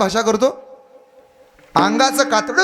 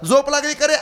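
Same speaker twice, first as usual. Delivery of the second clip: put-on old mother calling; 7 semitones higher than usual. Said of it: "पुन्हा म्हातारीनं आवाज दिला".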